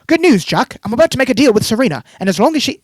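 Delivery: put-on a British accent read as a smug villain's phone call. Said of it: "you like my British accent?".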